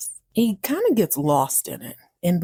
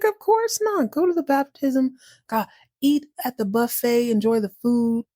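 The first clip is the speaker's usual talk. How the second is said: higher-pitched, cheery little mouse voice; encouraging mouse; 5 semitones higher than usual. Acted. Mouse urging cat to go to the baptism, high and encouraging. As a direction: wave a friend off happily, pitched up.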